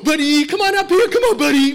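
put-on very high, loud, gushing baby talk to a dog; high, loud, gushing dog-owner voice. Shown as a demonstration. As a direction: coo at your dog, overexcited. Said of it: "You go".

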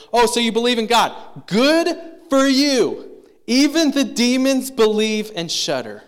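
high, loud mock praise, stretching "good for you"; exaggerated, scoffing applause in the voice; sarcastic congratulation. Preacher reading James to would-be believers, loud sarcastic congratulation.

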